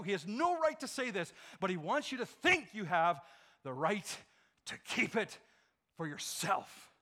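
loud emphatic preacher; full-force preaching. Acted. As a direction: press the warning hard.

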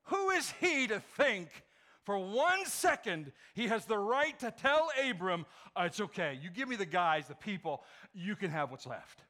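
loud indignant sermon; indignant preaching. Acted. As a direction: challenge the king's nerve.

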